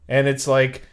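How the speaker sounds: ordinary talk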